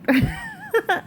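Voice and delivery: high, quick burst of giggles. Real, not acted. Vlogger tickled by the cute little toy, high giggle. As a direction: giggle lightly in amusement.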